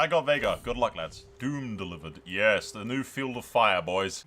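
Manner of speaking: ordinary delivery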